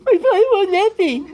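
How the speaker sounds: high, loud whining complaint; whiny voice. Retold or imitated. Eliezer whining to the prophet about his father, high and loud. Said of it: "I don't know if Eliyahu Hanavi said it that way".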